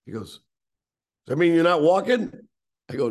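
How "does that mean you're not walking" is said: loud, higher-pitched mimicked shout; yelling, hard of hearing. Half-deaf club boss questioning the walker, yelling. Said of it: "he's yelling at me 'cause he's sort of deaf".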